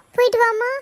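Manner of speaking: high, sugary mock send-off; mock sweet farewell. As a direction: sneer it sweetly, like a fake fond goodbye. Mocking the girl's farewell wish. (கேலியான இனிமையான விடைகொடுப்பு)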